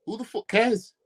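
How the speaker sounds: usual speaking voice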